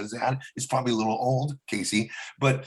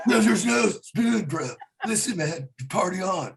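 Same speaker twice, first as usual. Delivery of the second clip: higher, slow surfer-dude Keanu voice; a light, laid-back Keanu drawl; Keanu Reeves impression; 5 semitones higher, slower than usual. Comic riffing on The Matrix, slow surfer-dude Keanu voice. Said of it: "Keanu Reeves".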